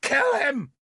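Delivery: high, urgent scream. A narrator's reading. Nimue turning on Merlin, screamed. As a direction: scream the order. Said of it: "she screamed".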